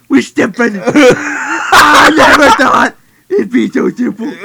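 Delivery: fake-cry it through laughter; hammed-up tearful wail; mock sobbing. Acted [name] breakdown.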